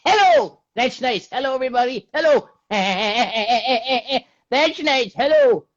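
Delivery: high loud Irish mammy greeting; Mrs Brown impression. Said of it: "Do Missus Brown with pleasure".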